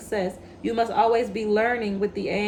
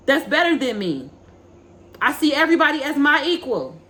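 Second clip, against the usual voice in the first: loud, higher, building sermon voice that pushes each line; rally the listener like a preacher at the pulpit; fiery preaching; 3 semitones higher, 5 dB louder than usual. Acted. Tarot reader urging viewers to know their worth, fiery preaching.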